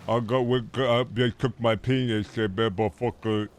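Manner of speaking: slow, garbled mock voice; mock dopey figure. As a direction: drag it out as a dopey caricature. Acted slurred mocking figure going nonsense.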